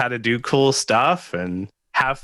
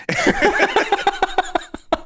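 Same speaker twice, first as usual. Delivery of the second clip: laugh. Real, not acted. Jokes about delivering a book.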